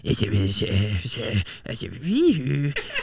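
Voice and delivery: goofy giggle. Retold or imitated. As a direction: cackle over the headset. Silly headset noise.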